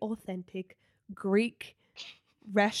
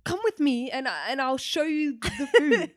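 put-on higher, louder laughing Greek accent; laughing imitation of a Greek waiter; mock Greek host; 6 semitones higher, 4 dB louder than usual. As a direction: beckon them in a hammed-up Greek accent. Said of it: "he's like".

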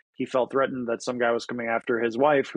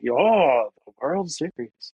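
imitating Eddie Izzard; 2 semitones higher, 3 dB louder, much slower than usual. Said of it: "Eddie Izzard has a joke".

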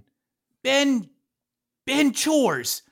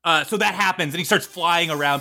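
normal talking voice

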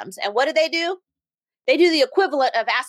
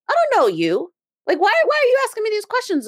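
high, protesting mock bafflement; pitched-up, quickening disbelief; incredulous; 11 semitones higher, 3 dB louder than usual. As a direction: act out pushing back at a nosy stranger. Acts out incredulous reply to stranger.

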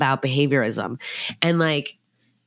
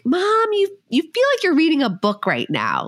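put-on kid's voice; 12 semitones higher than usual. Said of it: "my kid said".

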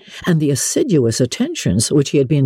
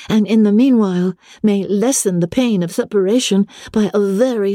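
higher, sweetly affected lady's voice; Caroline's letter voice; 6 semitones higher than usual. A narrator's reading. Jane reads Caroline Bingley's letter aloud, affected and sweet. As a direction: read it with gushing, high-flown politeness.

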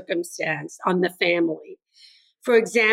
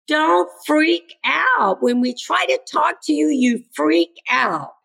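high, drawn-out student complaint; imitated teenager pleading with adults; exasperated plea; 6 semitones higher than usual. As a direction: stretch it out as a frustrated student would.

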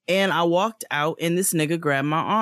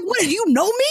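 much higher, louder angry yelling; furious retold yell; 13 semitones higher, 3 dB louder, faster than usual. Yelling at the man who grabbed her arm, much higher furious yell. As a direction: tear into him for grabbing you.

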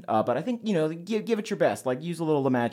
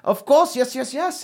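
excited; 9 semitones higher than usual. Acted. Twin eagerly agrees.

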